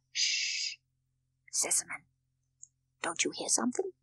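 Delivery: low-volume, urgent listening voice; suspenseful near-whisper; hushed alert. A narrator's reading. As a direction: hush your friend and ask softly. Doctor suddenly hears a noise.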